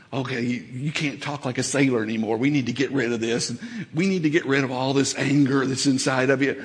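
lightly voiced Jesus, hushed and brisk; soft voice of Jesus. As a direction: murmur it as Jesus setting you straight. Jesus voiced correcting the believer.

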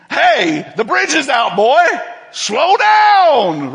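calling out a warning, rising high to a shout on slow down; emphatic warning. Pretend. God warning a reckless son, emphatic shout. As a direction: shout it like someone racing toward a broken bridge. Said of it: "he may get a little bit more emphatic".

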